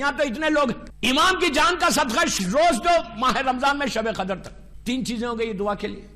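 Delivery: ordinary delivery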